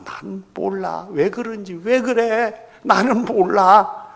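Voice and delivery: high, drawn-out protest; acted denial. Peter brushing off his accuser, drawn-out protesting denial. Acts Peter denying he knows Jesus.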